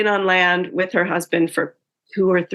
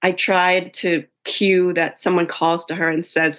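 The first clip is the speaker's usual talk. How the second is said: normal talking voice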